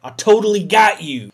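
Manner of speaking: loud, taunting gloat; loud mocking victory taunt; gloating bear. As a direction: rub it in, loudly. Bear gloating over you.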